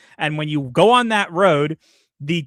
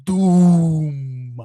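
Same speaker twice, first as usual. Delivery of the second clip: long, drawn-out villain boom; hammy accented villain proclamation; Doom. Shown as a demonstration. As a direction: stretch the word out long and grand. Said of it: "You have to say".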